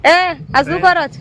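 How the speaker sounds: loud, high mock accusation; teasing call-out. Real, not acted. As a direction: call him out mid-act. Wife calls out to husband.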